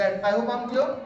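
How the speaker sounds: ordinary delivery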